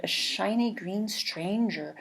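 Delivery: plain reading voice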